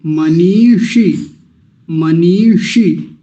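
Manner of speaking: मनीषी drawn out slowly, long ī and long final ī; teacher modelling मनीषी slowly; slow careful pronunciation. Shown as a demonstration. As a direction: stretch the vowels of मनीषी. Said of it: "बड़ी की मात्राएं हैं दोनों तो लंबा खींचना है".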